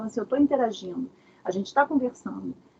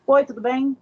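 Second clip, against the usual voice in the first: louder, matter-of-fact hello; brisk greeting; 3 semitones higher, 6 dB louder than usual. Shown as a demonstration. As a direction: greet in a firm, ordinary voice. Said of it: "É uma coisa você falar assim".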